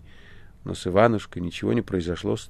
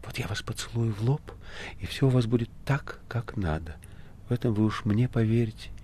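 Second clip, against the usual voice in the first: hushed, mildly soothing voice; soft reassurance; 5 dB quieter than usual. A narrator's reading. Margarita comforts Ivan before kissing him, softly. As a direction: soothe a patient gently. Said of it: "прошептала Маргарита".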